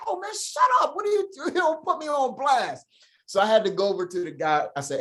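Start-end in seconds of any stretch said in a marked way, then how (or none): 0.1-2.9 much higher, laughing mock hush; hushed protest. Man hushing his friend at the range, high laughing protest. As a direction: squeak out a panicked shut up.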